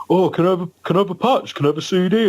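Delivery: high, eager pestering voice; pestering fan. Retold or imitated. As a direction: fan nagging the singer backstage, high and eager. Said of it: "was just like".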